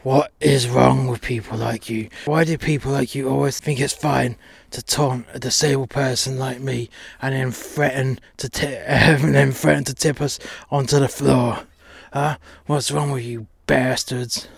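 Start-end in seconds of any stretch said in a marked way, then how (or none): none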